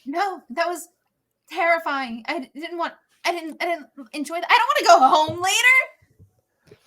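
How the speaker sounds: high-pitched upset protest; rattled. Acted. Upset after tense carriage ride.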